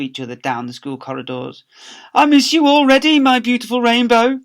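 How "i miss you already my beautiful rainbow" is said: high, loud lovesick call; Mister Lovey calling, besotted; lovestruck calling. Acted. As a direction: call down the corridor, smitten. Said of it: "he would say".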